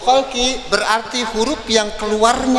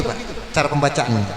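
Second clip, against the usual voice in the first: usual speaking voice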